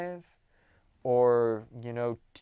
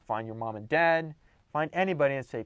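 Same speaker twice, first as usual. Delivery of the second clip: usual speaking voice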